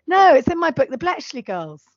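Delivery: loud, high indignant protest. Real, not acted. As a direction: set the record straight, outraged. Indignant correction of co-host.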